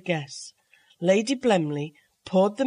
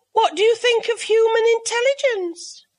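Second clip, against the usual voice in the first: high, louder lady's voice; a lightly voiced silly lady, pitched up; Mavis's voice; 6 dB louder than usual. A narrator's reading. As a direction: guest asking the cat, high and eager. Mavis Pellington asks.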